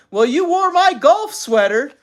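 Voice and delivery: high, loud retort; quarreling comeback. A narrator's reading. Mother answering father's accusation, quarreling loudly. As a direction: throw it back indignantly, rising at the end.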